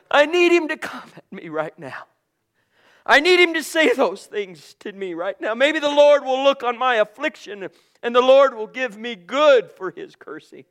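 loud, rising preacher's cry; preaching at full force. Acted. Preacher voicing David's resolve, loud and fervent. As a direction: build it up like a revival preacher.

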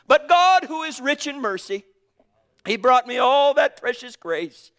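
loud, high, fervent proclaiming; full-force preaching. Acted. Preacher exalting God's mercy over the law, loud and fervent. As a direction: proclaim it with fervour.

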